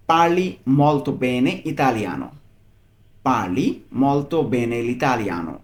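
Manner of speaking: very slow, every syllable laid out; slow model phrase. Shown as a demonstration. Teacher models the Italian phrase for a learner, very slowly. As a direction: read it out slowly so a learner can copy. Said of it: "এটার Italian কি?".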